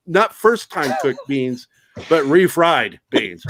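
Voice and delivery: slow deadpan punchline. Retold or imitated.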